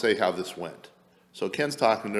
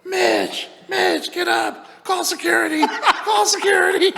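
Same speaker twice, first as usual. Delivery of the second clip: high, loud mock scream for help; hammed-up shriek breaking into laughter; imitated panicked yell; 8 dB louder, slower than usual. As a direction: yell it very high, stretching out the last repeats. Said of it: "I hear Ken yell".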